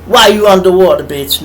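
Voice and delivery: ordinary talk, nothing marked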